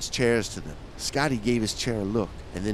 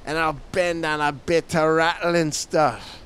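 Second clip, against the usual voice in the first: higher, louder faux Scottish accent; attempted Scottish accent; 6 semitones higher, 5 dB louder than usual. Finishing the sailor's request, attempted Scottish accent. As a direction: push a shaky Scottish accent, half British. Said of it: "I'm gonna try to get a Scotty accent".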